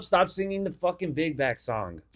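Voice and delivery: normal talking voice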